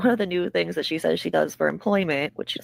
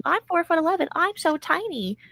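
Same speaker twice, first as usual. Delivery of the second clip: mock cutesy; 11 semitones higher than usual. Mocking a streamer obsessed with her height, high cutesy. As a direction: play it baby-cute and smug. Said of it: "She's like".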